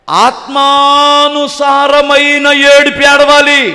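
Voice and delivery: very slow, high, loud proclamation; emphatic preaching. Acted. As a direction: lift the voice and stretch each word. Preaching climax. (నెమ్మదిగా పెద్ద గొంతుతో ప్రకటన)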